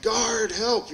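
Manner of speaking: high, loud frightened call to the guard; mock cry for help. Cuffed to a bench, calling the guard for help in mock fright. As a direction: yelp for the guard as if about to be hit.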